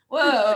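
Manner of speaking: loud, high "whoa" thrown out quickly; fast voice exercise. Shown as a demonstration. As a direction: let a big whoa fly, unselfconscious. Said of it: "really fast".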